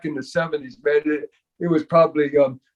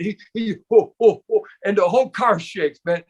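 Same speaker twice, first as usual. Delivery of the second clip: normal talking voice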